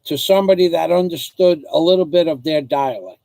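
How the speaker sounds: ordinary talk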